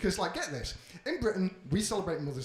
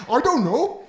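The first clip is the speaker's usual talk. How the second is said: loud, high crooning singer voice; 6 semitones higher, 10 dB louder than usual. Retold or imitated. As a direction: croon it like a Welsh showman. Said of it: "made me sound like [name]".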